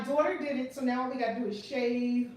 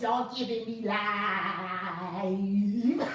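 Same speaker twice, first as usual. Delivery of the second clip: hugely drawn-out comic impression, breaking into laughter; Tiffany Haddish; 4 dB louder, much slower than usual. Host channels a comedian for her live viewers, hammed-up impression. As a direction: stretch the last word out long, laughing. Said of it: "in my Tiffany Haddish voice".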